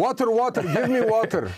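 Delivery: a hammed-up parched fighter begging for water; thirsty soldier, hammed up. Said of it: "I like that".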